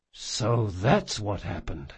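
soft, slow and tender; very gentle. Acted. Jean answers the dying Locke softly and slowly, very gently. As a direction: ease the words out gently. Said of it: "Jean said very gently".

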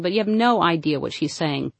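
ordinary delivery

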